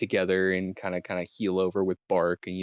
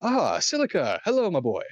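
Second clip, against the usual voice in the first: high, loud cheerful greeting; Ampho greeting; 9 semitones higher, 5 dB louder than usual. Acted. GM as Ampho greets Silica, high and loud. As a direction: greet Silica warmly and loudly, pitched up. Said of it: "He says".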